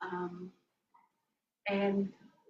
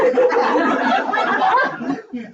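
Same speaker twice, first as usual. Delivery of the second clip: big high laughter; loud laugh; 12 semitones higher, 10 dB louder than usual. Real, not acted. Laughing at the story.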